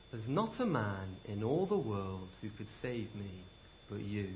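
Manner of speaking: a quiet gentle echo of the thanks; tender repeat. Acted. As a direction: let it sink in softly. Repeated softly for effect.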